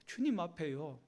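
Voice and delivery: ordinary talk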